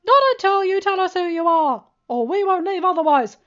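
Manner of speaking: defiant. A narrator's reading. Discord defying the voice.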